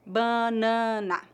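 "Banana" very slowly, first two As nasal. Shown as a demonstration. Teacher showing learners the three vowels, nasal As. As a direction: stretch "Banana", nasal vowels, weak final A. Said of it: "Os dois primeiros são nasais".

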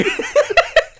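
high laughing burst; cracking up loud and high; burst of laughter. Real, not acted. Hosts laughing about a silly film line, loud laugh. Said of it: "I'm gonna be quoting".

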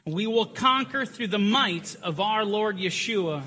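slow, loud, high chant-like declaration; leading the flock in a slow, loud, high vow; congregational battle cry. Real, not acted. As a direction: declare it together like soldiers before war. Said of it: "And we all read".

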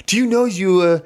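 high, hammed-up French accent in broken English; mock her French accent back at her; exaggerated fake French accent, pitched high; strange French-ish accent. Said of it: "I put on this strange, you know, accent to match her accent".

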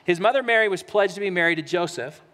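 preacher reading Matthew aloud; scripture reading. Acted. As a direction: read the passage with reverence. Reads scripture passage.

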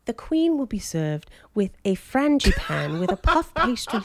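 measured mock British presenter; refined British caricature; British baking-show accent. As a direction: announce a dish in prim mock British. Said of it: "Great British Bake Off".

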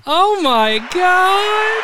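high, slow drawn-out squeal of delight; excited squeal. Real, not acted. Thrilled by the winner, a high, slow squeal.

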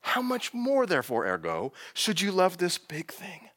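a slow, knowing divine challenge; voicing God. Preacher voicing God's challenge to Jonah, lightly. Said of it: "God's gonna say".

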